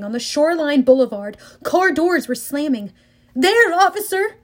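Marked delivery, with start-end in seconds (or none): 3.3-4.4 high excited shout; yelling. Acted. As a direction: call out to the police. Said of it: "somebody yelled".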